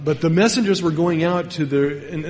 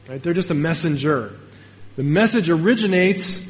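ordinary delivery

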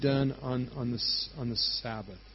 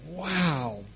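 awed crowd reaction. Retold or imitated. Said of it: "all the people in the crowd".

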